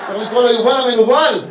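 loud, high mock indignation; hammed-up angry outburst; comic rant. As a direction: yell it like an aggrieved customer. Mock rant about children's entertainer.

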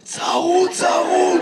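a hoarse voice that really scrapes. Real, not acted. Audience man answering the comedian, hoarse. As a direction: push the words out through a raw throat. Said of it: "למה אתה נשמע ככה".

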